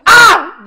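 high loud wail; anguished cry. Acted. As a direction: wail in despair. Cries out at loss.

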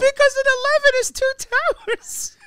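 very high, loud giddy squeal; helpless glee. Real, not acted. Comic explaining his own punchline, real high squeal.

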